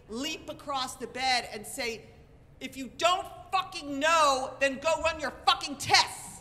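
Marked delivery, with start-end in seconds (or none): 2.6-6.4 loud, high imitation of furious husband; husband's angry outburst. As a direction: explode at the doctor, acted. Husband leaps up, swears at doctor.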